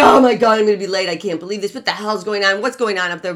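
exasperated traffic rant, loud outburst at the start; mock griping. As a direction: gripe like a fed-up driver stuck in traffic. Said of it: "you're bitching".

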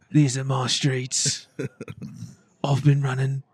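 slow gangster in a put-on British accent. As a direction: imitate a comic playing a British-accented gangbanger. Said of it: "he was doing a British accent".

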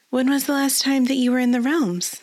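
a hint of a young lady asking; lady's voice. A narrator's reading. Lady asks Doric a question.

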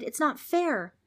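put-on high-pitched childish protest; indignant shout. A narrator's reading. Trix objects to the ghost, shouting. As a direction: cry out at the injustice. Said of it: "Trix shouted".

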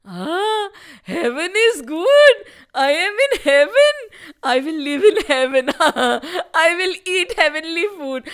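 high, chuckling villain voice; gloating delight. A narrator's reading. Trishanku revels on reaching heaven, gloating delight. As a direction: savour it with loud, greedy glee.

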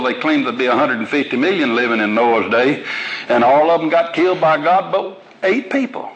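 ordinary talk, nothing marked

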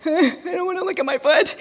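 a light imitation of a panicking child; scared kid voice. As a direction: play the frightened son who won't look. Child refusing during tack story.

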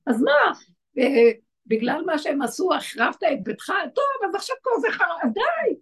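a hint of a mother losing patience; Rachel scolding. Rachel telling God to stop mourning, high and impatient. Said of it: "והוא מתחילה להגיד לו".

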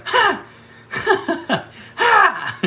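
very high triumphant ha, loud bursts; triumphant laughing. Retold or imitated. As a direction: crow with delight at the proof. Hearing the confirming names.

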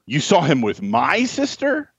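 "my" drawn out, then rising high into a question; stress on "my". Shown as a demonstration. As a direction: query whose sister by leaning on "my". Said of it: "three very different sentences".

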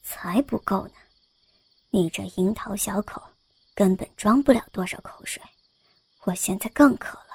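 low, hushed, teasing male voice; teasing young scholar. A narrator's reading. Young scholar teasing the maid, low hushed tease. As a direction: breathe it low and sly. Zhang Sheng voiced in story.

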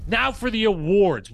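slow, high and loud segment announcement; hosting a recap podcast, booming segment intro; announcer intro. Acted. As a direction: announce the next segment like a show host.

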